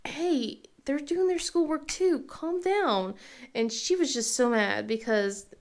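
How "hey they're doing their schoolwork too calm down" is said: lightly raised, calming tone; mom soothing. Retold or imitated. Mom calming her daughter, a touch higher. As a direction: talk her down gently. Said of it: "And I was just like".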